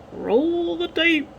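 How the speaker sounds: high, stretched-out singsong call; drawn-out cue call. Pretend. Calls the cue for the clip.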